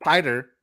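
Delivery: "Spider" without its S, as "PIE-der"; S left off. Shown as a demonstration. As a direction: start "Spider" straight on the P. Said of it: "you wouldn't pronounce this one".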